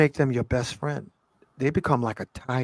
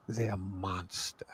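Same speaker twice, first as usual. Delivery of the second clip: whispered; 6 semitones lower, 14 dB quieter, much slower than usual. Real, not acted. Serious point on integrating monsters.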